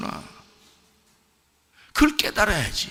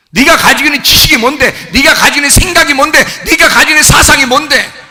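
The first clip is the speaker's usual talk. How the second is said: higher and louder, demanding each question; building rebuke; 7 semitones higher, 7 dB louder than usual. Acted. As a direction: press harder on each 뭔데. Climbing into loud rebuke.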